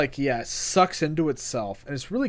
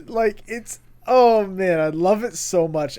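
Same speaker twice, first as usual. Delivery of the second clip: excited; 5 semitones higher, 6 dB louder than usual. Real, not acted. Gushing over the mech.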